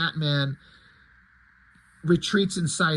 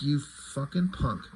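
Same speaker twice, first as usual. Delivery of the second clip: sullen glare; 4 semitones lower, 6 dB quieter than usual. Acted. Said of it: "Dick Grayson just looks on like".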